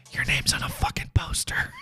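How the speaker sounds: dry hushed whisper. Retold or imitated. Eric whispering the obvious answer back, hushed whisper.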